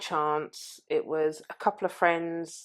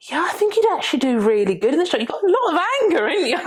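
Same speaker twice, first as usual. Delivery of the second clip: high, loud and fast, a gushing impressed friend; excited verdict; 11 semitones higher, 7 dB louder, faster than usual. Retold or imitated. Friend telling her she'd suit the job, high excited gush. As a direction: blurt it out, delighted and impressed.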